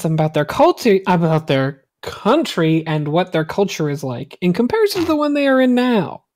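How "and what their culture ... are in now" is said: ordinary talk, nothing marked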